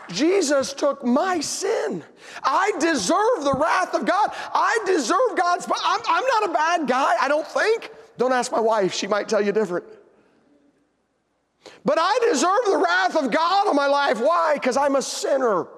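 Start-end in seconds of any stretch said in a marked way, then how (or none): 0.1-7.9 high, drawn-out impassioned declaration; full-force preaching. Real, not acted. Preacher confessing his own sin to congregation, impassioned preaching. 11.8-15.7 full-force preaching. Real, not acted. Preacher confessing his own sin to congregation, impassioned preaching.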